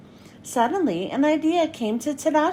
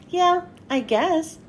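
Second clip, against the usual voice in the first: shrugging; 5 semitones higher, slower than usual. Acted. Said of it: "Hiro shrugged".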